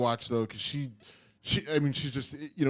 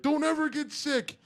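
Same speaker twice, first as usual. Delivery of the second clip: mock desperate plea. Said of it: "with my daughter in a headlock".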